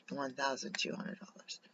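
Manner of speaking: whispered price aside. Real, not acted. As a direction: breathe the amount like a secret.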